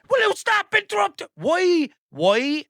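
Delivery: very high, loud exasperated protest; exasperated outburst. Real, not acted. Host exasperated at AI interrupting him.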